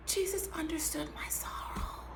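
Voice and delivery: soft, hushed confession; intimate whisper. Real, not acted. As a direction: lean in and whisper it. Intimate aside about Jesus.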